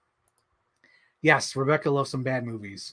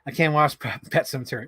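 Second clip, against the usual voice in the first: ordinary delivery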